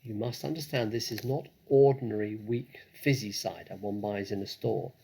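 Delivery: ordinary delivery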